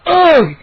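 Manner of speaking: loud, high choked groan; gagging. A narrator's reading. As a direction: gag as if being strangled. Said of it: "I gagged".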